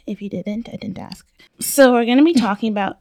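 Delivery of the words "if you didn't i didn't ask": muttered. Real, not acted. Deadpan joke aside.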